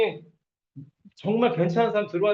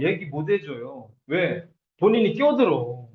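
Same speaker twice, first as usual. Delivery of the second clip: ordinary talk, nothing marked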